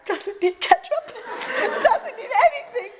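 high-pitched, hammed-up questioning; bewildered husband. Newlywed husband asking crying wife about supper, hammed-up bewilderment. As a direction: play a clueless husband, voice climbing.

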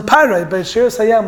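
usual speaking voice

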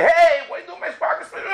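indignant shout over a parking space; angry yell. Retold or imitated. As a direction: snap at the guy in your spot. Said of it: "you're screaming at somebody else".

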